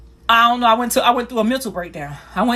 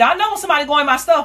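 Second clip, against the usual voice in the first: higher and quicker, sure of herself; knowing grandma; 6 semitones higher, faster than usual. Retold or imitated. Grandma warning she knows when her stuff is touched, higher and fast. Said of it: "She said".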